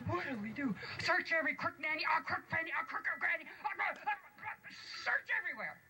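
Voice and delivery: high, fast dwarf voice; flustered dwarf. Acted. Dwarf urging the others to search, high and fast.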